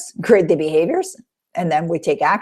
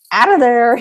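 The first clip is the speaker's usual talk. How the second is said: loud, high call like an umpire's "out"; a touch of an umpire throwing a player out; 5 semitones higher, 6 dB louder than usual. Retold or imitated. Coach playing an umpire ejecting a negative word, loud call. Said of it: "like the umpire".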